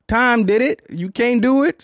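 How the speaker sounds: high hyped-up exclamation; excited. Real, not acted. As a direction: shout it up with hype. Hyping up friend's fitness.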